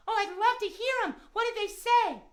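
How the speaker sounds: high kitty puppet voice; eager puppet cat. Pretend. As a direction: ask keenly in a high cat voice. Puppet cat asks host.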